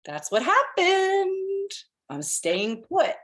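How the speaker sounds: high, slow, laughing squeak into a chuckle; amused. Real, not acted. Telling friends how the reading came true, amused high squeak. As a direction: squeal it out through amusement, then settle.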